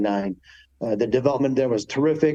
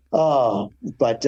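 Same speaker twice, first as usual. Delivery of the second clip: usual speaking voice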